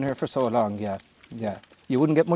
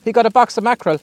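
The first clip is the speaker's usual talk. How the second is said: calling out; 9 semitones higher, 9 dB louder than usual. Real, not acted. Repeating across the water.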